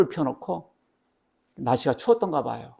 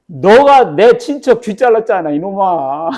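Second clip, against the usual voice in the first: loud, high, drawn-out mock indignation; hammed-up outraged witness; acted angry accusation; 5 semitones higher, 11 dB louder, slower than usual. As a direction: accuse him in a raised, stretched-out voice. Acts out relative accusing Peter.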